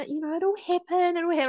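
high, mocking sing-song; mocking nurses. Mom mimicking nurses' empty reassurance, high. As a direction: parrot them in a high voice. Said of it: "they just keep going".

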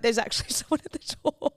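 retold shock tipping into giggles; laughing weirdly. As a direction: laugh through the words in disbelief. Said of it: "laughing weirdly".

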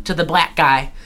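ordinary talk

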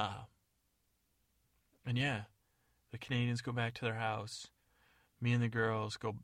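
normal talking voice

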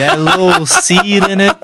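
high mock southern twang through amusement; hammed-up southern accent, pitched right up; smooth southern accent. Podcast host joking as the southern friend, high southern accent. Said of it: "Lee has a smooth ass accent".

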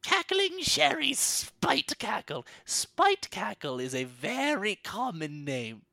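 put-on high, slow, indignant old crone; old hag voice. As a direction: correct them like an offended old woman. Hag correcting her name.

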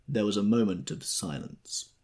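ordinary delivery

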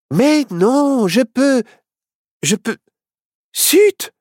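high, halting attempts ending in loud "zut"; falter, then burst out in frustration; struggling little witch; flustered. Witch's magic fails her.

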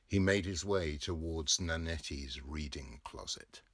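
plain reading voice

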